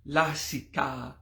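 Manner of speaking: LASIKA slow, with both A's long and open; slow, evenly sounded la-si-ka; varied vowel intonation. Shown as a demonstration. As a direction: stretch the A's in lasika, syllable by syllable. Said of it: "depending on the intonations of the vowels".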